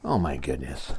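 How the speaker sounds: usual speaking voice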